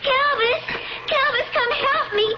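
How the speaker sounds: high, crying pleas for help; frightened pleading. Acted. Lydia being pushed into the tomb, frightened pleading. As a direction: struggle and call out in fear. Girl pushed through door.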